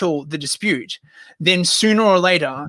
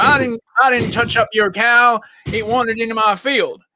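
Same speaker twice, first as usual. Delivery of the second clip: higher-pitched hammed-up American farmer; bad US accent; 5 semitones higher than usual. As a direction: protest in a crude put-on American accent. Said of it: "crap U S accent".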